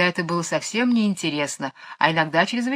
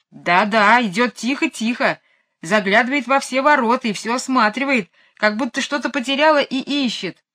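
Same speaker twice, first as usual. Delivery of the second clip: higher, eager running report; excited reporting; 5 semitones higher, 3 dB louder than usual. A narrator's reading. Michael at the window telling Jane about the cow, excited.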